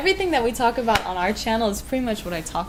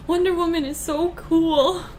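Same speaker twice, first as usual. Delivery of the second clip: excited gasp; 8 semitones higher than usual. Real, not acted. Gasping at the retro drawing.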